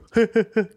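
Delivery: put-on high, impish old-lady giggle; grandma giggling after farting; cheeky giggle. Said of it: "she'll just go".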